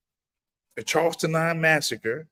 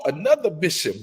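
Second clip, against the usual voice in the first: ordinary talk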